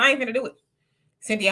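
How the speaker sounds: ordinary talk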